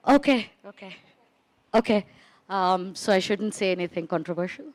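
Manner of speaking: ordinary talk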